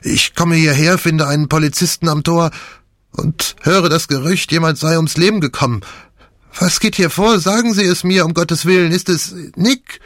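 very high, agitated pleading; pained dread. A narrator's reading. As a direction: frantically beg for news. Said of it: "Der Schmerz in seiner Stimme".

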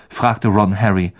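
ordinary delivery, nothing marked

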